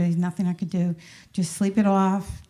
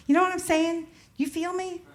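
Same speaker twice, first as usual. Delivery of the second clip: high, mock street slang, pitched up; playful copy of the jive they overheard; imitating jive talk; 10 semitones higher, 4 dB quieter than usual. Mother joking with her daughter, mock jive talk. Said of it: "So we'd say to each other".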